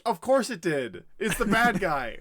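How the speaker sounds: mock-outraged retort; exasperated. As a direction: spell out the obvious in disbelief. Acted Gandalf exasperated at Denethor.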